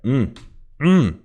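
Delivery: high-pitched, savouring hum; appreciative mm. Real, not acted. Savouring the verse just played.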